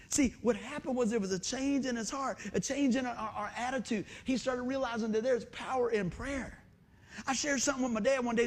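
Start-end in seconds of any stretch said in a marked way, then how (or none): none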